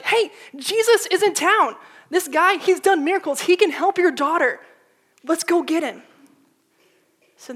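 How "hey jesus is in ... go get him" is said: urgent excitement. Retold or imitated. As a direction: burst in with hope. Messenger rushing in.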